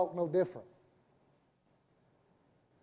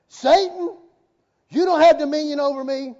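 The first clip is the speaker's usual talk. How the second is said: loud, high, commanding declaration; retold rebuke; 6 semitones higher, 8 dB louder than usual. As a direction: order the devil off with authority. Retells his rebuke of Satan.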